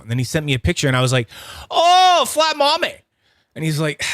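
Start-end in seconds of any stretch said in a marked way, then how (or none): 1.7-3.0 excited exclaim. Retold or imitated. Mocking friend's name for spinner.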